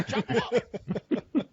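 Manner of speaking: slow, high mock ninja growl into a laugh; playful ninja line dissolving in laughter; hammed-up ninja voice. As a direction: drag it out as a silly ninja. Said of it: "I went all ninja on you".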